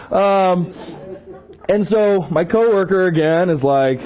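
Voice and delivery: usual speaking voice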